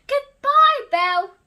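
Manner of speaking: a father calling goodbye to his daughter; dad's goodbye. Acted. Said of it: "he said".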